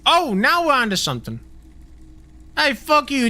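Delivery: hammed-up Jersey tough guy; South Jersey accent. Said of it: "It's actually very Staten Island".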